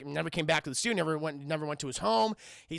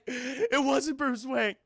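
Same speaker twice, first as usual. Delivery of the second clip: slow, raised-pitch frightened denial; frightened pleading; 5 semitones higher, much slower than usual. Retold or imitated. Man recanting to Batman, frightened pleading. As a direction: plead slowly, pitched up, fear held in.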